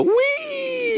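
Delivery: very high, drawn-out whee; long falsetto glee, acted out; playful squeal. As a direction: squeal like you're flying down a hill. Acts out flying down a hill.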